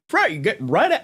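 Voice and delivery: high, lightly mocking Australian accent; a quick Australian accent; joking Australian accent; fake Australian accent. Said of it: "I'm done doing accents".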